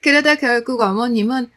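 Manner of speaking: ordinary delivery, nothing marked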